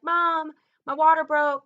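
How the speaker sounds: high, loud yell calling downstairs; retold scream to mom. Daughter yelling to her mom that her water broke, loud. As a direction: shout it up high, panicked. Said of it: "and I'm screaming to her".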